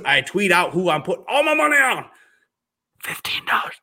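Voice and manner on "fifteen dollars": sheepish unvoiced whisper of the small sum. Real, not acted. Realtor confessing his tiny house bet, embarrassed whisper. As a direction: whisper it like a secret.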